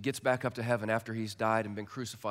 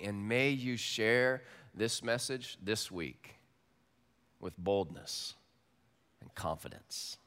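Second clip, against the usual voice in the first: benediction; 4 semitones lower, slower than usual. Real, not acted. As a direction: bless them gently, slowly. Closing benediction.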